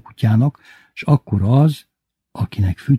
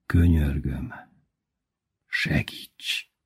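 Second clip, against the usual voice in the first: softer, breathy whispered begging; quiet plea; 5 semitones lower, 6 dB quieter, much slower than usual. Acted. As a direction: barely voice the plea for help. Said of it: "Halkan szólalt meg". (halk, suttogó könyörgés)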